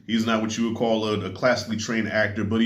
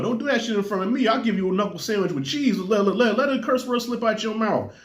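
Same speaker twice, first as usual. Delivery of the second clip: higher-pitched mock threat; scolding parent; 8 semitones higher than usual. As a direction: play a dad warning his kid off cursing. Acts parent threatening his son.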